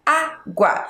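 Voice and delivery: "Água" in two beats, Á, GUA; split into syllables. Shown as a demonstration. Teacher starting the exercise, "Água" sounded out. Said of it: "Vamos fazer o mesmo exercício".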